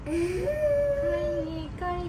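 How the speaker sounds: long, very high, drawn-out coo. Real, not acted. Mother coos to her crying toddler, high and drawn out. Soothing crying child after bandage.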